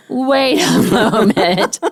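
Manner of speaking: put-on high, loud, slow childlike surprise with a laugh; girl realizing the aunts aren't sisters; dawning realization. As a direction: let it dawn on her, stunned. Daughter realizing.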